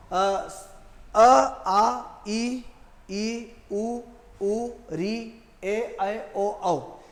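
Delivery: each Hindi vowel sounded out one by one, with short pauses; vowels recited clearly. Shown as a demonstration. Teacher to students, reciting Hindi vowels carefully. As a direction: recite the vowel chart slowly for the class. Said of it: "ऊ इनका उच्चारण जैसा है वैसा ही किया जाएगा".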